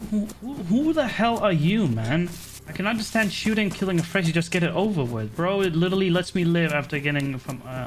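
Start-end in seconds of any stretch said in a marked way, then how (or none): 0.1-2.3 mock Russian accent. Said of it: "I'm gonna tell him".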